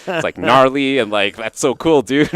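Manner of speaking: laughing, high, loud surfer dude; hammed-up surfer, laughing through it; Californian surfer voice. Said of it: "sound like a Californian surfer".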